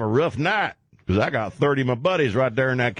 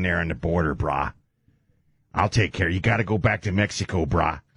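Dog the Bounty Hunter impression; 7 semitones lower than usual. Host imitates Dog telling a migrant to go back, low and easy. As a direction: talk like a chill surfer tough guy. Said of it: "Dog the Bounty Hunter".

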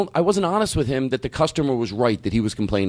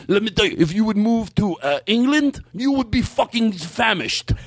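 high, indignant, increasingly drawn-out mimicry; imitating his voice; 6 semitones higher, slower than usual. Comedian voicing the man's complaint to a customer, high indignant imitation. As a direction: mimic the man's rant, high then slowing for the punchline. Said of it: "do him doing that voice".

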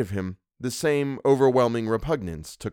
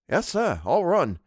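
higher-pitched Cockney admission; Cockney dialect; 7 semitones higher, slower than usual. A narrator's reading. Servant admitting to his employer he ran, in Cockney. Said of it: "Thomas's Cockney dialect".

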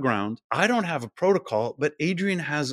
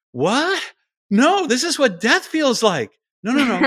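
hammed-up panic, much higher and louder; panicking toes; 11 semitones higher, 7 dB louder than usual. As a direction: shriek like the toes are freezing to death. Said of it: "they'll say".

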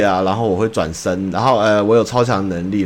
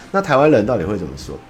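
usual speaking voice

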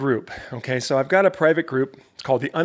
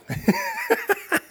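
high delighted laugh. Real, not acted. Laughs at own impression.

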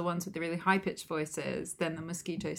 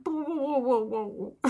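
high, breathy "whoa" laugh; Buggette's laugh; 7 semitones higher than usual. Retold or imitated. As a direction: giggle out each whoa, pitched up. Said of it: "her laugh is, like, her going".

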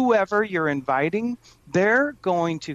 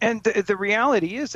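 ordinary talk, nothing marked

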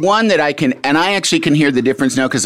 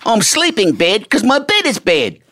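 higher, playful Kiwi ad line; light Kiwi voice; 9 semitones higher than usual. Pretend. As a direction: keep the Kiwi joke going. Continuing the Kiwi bit.

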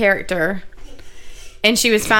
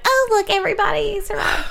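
mock cheerful; 14 semitones higher than usual. Mocking a happy ending.

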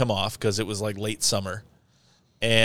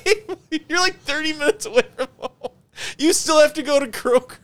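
very high, squeaky with laughter; incredulous retold protest through giggles; laughing disbelief. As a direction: crack up while delivering the punchline. Incredulous punchline told through laughter.

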